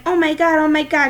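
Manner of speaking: loud, high thrilled cry; screaming with excitement. A narrator's reading. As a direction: squeal it out in delight. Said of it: "Mom was screaming with excitement".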